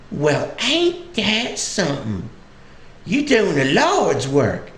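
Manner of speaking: high, slow Southern drawl; Southern old lady. Retold or imitated. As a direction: marvel slowly like a high-voiced country granny. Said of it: "sweet little old lady".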